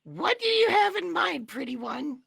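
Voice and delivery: very high, loud squeaky voice; a flirty cartoon critter; Rocky voice. GM keeps Rocky voice.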